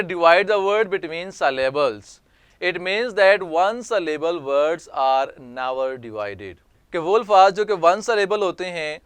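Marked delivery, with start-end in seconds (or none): 0.0-6.7 slow, deliberate dictation reading; careful classroom reading voice; slow read-aloud. Shown as a demonstration. Teacher reads rule text aloud.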